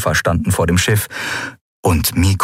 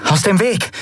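high, urgent order; acted sharp command; demanding; 12 semitones higher than usual. A narrator's reading. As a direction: demand they clear the way. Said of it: "forderte Endriel".

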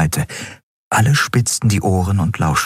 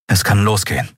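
touch of resolve; 3 semitones higher than usual. A narrator's reading. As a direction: say it with mild resolve. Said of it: "sagte er entschlossen". (leicht entschlossen)

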